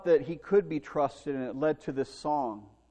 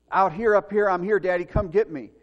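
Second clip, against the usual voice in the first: loud, higher calls for help; calling out; 5 semitones higher, 8 dB louder than usual. Retold or imitated. Boy in the storm calls his daddy, loud and higher. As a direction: call down to be found.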